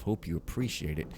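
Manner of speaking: ordinary talk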